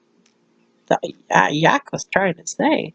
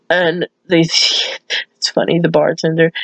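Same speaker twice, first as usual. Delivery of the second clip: ordinary delivery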